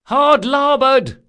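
high, drawn-out boat call; loud cry. A narrator's reading. Girl at the bow calling to rowers, loud cry. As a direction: call it out hard across the water, pitched high. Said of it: "cried the girl at the bow".

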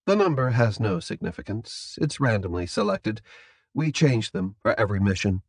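soft, factual captor voice; composed captor. Acted. Captor explaining code numbers to prisoner, soft and composed.

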